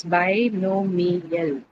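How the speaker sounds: binomial said slowly and clearly for the class; slow, careful teacher's repeat; slow dictation. Shown as a demonstration. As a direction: draw binomial out slowly for students to count syllables. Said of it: "I'll say that again".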